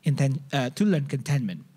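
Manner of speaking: ordinary talk, nothing marked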